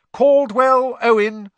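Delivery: loud, high, stretched roll call; calling out names. A narrator's reading. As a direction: summon the pupil slowly and loudly. Teacher calls out name.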